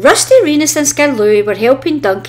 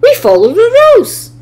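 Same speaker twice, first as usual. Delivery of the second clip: high, loud cross insistence; indignant, pitched up and loud; a cross little engine laying down the rules; crossly; 13 semitones higher, 7 dB louder than usual. A narrator's reading. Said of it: "Rusty huffed crossly".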